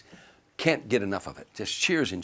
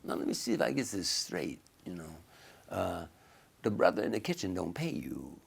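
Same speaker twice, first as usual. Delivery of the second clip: imitating [name]; 2 semitones lower, 4 dB quieter than usual. Drunk star cornering the comic at a party, lightly imitated. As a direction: quietly demand an explanation, in a slight impression. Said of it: "he's talking to me like I'm another brother".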